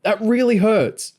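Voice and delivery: high, loud wail of pain; pained gasp. A narrator's reading. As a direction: complain through the pain. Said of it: "James gasped".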